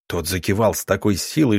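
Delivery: ordinary delivery, nothing marked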